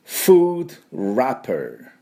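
very slow careful "food wrapper"; "food wrapper" with a real F and rolled-back R, drawn out; English pronunciation. Shown as a demonstration. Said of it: "英語でなんていうかっていうと".

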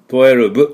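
"twelve" as to-e-ru-bu with a hard b; "twelve" with full vowels and a b ending; katakana twelve. Shown as a demonstration. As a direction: say "twelve" the wrong way, Japanese syllables, final bu. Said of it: "っていうんではなくて、".

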